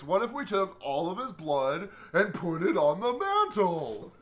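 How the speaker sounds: slow, dopey cartoon starfish voice; Patrick meme voice. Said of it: "Patrick Meme voice".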